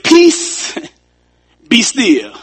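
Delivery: a sudden high shout on Peace, then a firm be still; commanding Jesus. Acted. Jesus rebukes the storm from the ship, high ringing command. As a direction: command the wind and waves to stop.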